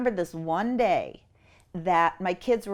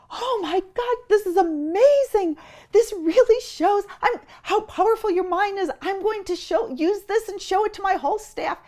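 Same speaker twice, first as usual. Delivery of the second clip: high, gushing Oprah imitation, crying out; 13 semitones higher, 5 dB louder than usual. Mother mimics Oprah flipping out on TV, high gushing. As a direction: squeal it like an amazed talk host. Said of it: "but Oprah's like".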